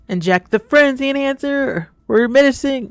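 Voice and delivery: hammed-up high-pitched announcing; playful mock announcement. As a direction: call it out with mock ceremony. Mock-announcing the injection in the game.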